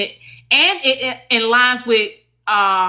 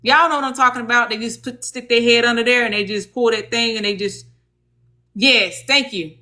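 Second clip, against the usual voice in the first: normal talking voice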